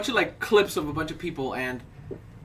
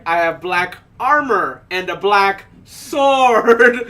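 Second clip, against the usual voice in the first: drawn out word by word, louder; slow solemn boast; 6 dB louder, much slower than usual. Retold or imitated. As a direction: insist slowly and proudly. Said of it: "He's like".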